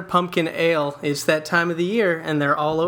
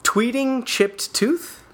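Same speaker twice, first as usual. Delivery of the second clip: mocking; 8 semitones higher, much slower than usual. Teasing next headline.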